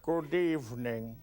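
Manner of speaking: slow, low, deadpan Hitchcock drawl; a hint of Alfred Hitchcock; Hitchcock impression. Host greeting the audience in a slow lugubrious Hitchcock voice. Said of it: "Let's do some Hitchcock".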